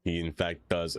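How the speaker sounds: ordinary delivery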